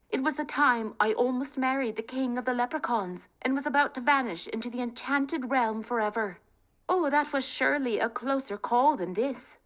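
rally the family, high and eager; spirited young woman. Acted. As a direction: bold daughter talking her family round. Kate voiced.

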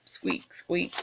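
tiny whispered squeak, squeak. Acted. Mouse squeaks after refusing frog, whispered squeaks. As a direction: breathe the squeaks out voiceless. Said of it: "squeaked the mouse".

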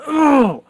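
loud, high exasperated ugh; frustrated groan. Real, not acted. Fed-up driver groans at his dead car. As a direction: let the anger burst out in one grunt. Battery still won't connect.

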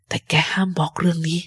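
whispered warning; secret whisper. Acted. Captain warns Jim to keep the secret, whispered. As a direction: breathe it so no one hears.

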